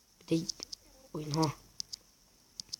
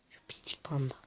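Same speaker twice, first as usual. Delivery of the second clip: whispering; 3 semitones lower, 10 dB quieter than usual. Real, not acted. Whispered insult at panda.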